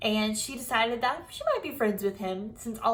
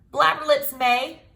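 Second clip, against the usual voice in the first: louder, higher yell; 3 semitones higher, 7 dB louder than usual. Acted. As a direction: snap back at her loudly. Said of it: "I yelled".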